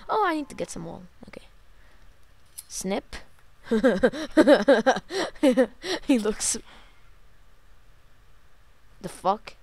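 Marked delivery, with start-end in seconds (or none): 3.2-6.0 big loud laugh; loud burst of laughter. Real, not acted. Sheep shearing gag.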